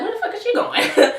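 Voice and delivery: high-pitched, laughing disbelief; comically bewildered high voice with a laugh; incredulous. Retold or imitated. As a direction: squeak it out, baffled and amused. Baffled aside as woman follows them.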